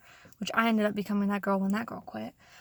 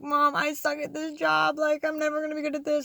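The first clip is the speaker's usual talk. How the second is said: mock tearful complaint, voice pitched up; retold crying; 4 semitones higher than usual. New worker on phone with mom after first day, tearful. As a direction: imitate wailing to mom, higher but not sobbing. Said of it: "like, crying".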